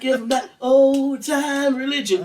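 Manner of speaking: put-on rousing old-time gospel holler; revival preacher. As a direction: belt it out like a tent-revival preacher. Acts out revival preacher's rousing cry.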